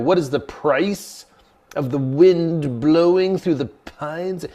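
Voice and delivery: high, slow, incredulous echo of a riddle; mock-bewildered student repeating the question; baffled repeating. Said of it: "it doesn't even make sense".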